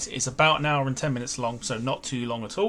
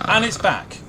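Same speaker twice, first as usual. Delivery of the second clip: surprised exclamation; 9 semitones higher, 9 dB louder than usual. Real, not acted. Graphic reappears, surprised.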